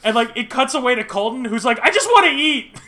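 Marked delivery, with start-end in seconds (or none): 1.8-2.8 high, loud imitation of a frustrated eater; mock exasperated whine. Colton fed up with etiquette rules, mock exasperated whine. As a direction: plead it in exaggerated frustration. Retold exasperated contestant whining.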